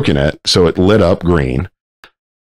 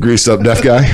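usual speaking voice

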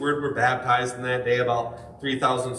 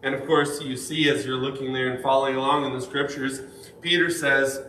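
ordinary talk, nothing marked

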